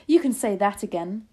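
loud, hearty agreement; crying out. A narrator's reading. Grandmother agreeing with grandchild, crying out. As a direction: agree at full voice. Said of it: "my grandmother cried".

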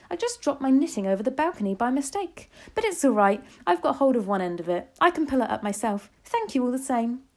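high, cool grandmother's voice; cool and composed. A narrator's reading. Grandmother covering up to the witch, coolly composed. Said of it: "I marvelled at the coolness of her voice".

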